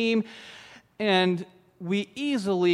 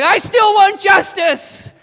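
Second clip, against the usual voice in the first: much higher, louder and slow, a desperate cry; shouted demand; 13 semitones higher, 12 dB louder, slower than usual. Retold or imitated. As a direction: yell it as you're dragged out. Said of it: "she's yelling".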